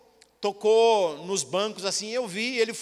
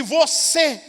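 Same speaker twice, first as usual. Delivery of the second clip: a sudden loud, higher call; single out the man in the crowd; 4 semitones higher, 6 dB louder than usual. Retold or imitated. Blind prophet singling out the preacher, called out loud.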